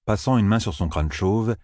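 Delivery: usual speaking voice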